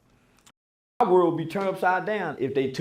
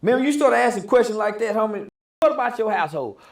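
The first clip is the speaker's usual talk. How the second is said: ordinary talk, nothing marked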